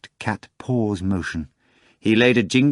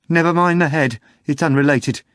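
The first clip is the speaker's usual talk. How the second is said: agitated loud; 7 semitones higher, 5 dB louder than usual. A narrator's reading. Agitated bartender interrupting.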